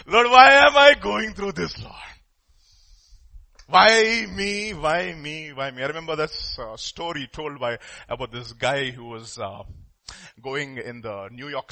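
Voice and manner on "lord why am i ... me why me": loud, raised wail that fades; wailing complaint. Acted. Believer asks the Lord why me, acted wail fading. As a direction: start loud and high, tail off to usual.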